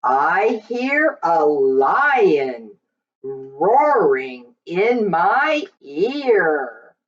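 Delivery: plain reading voice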